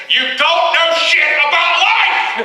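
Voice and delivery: slow, high, loud shout. Real, not acted. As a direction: yell it out, dragged slowly. Ranting punchline at [name].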